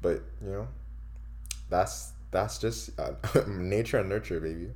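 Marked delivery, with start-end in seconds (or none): none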